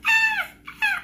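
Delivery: high yelping ow ow, loud; sharp high cry of pain. Retold or imitated. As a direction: yelp in pain at a stuck contact. Contact stuck, pained cry.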